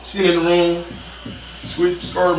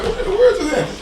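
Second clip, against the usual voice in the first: high-pitched, incredulous retold exclamation; retold bewilderment. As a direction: replay your own baffled alarm, pitched way up. Retells own alarm at racing heart.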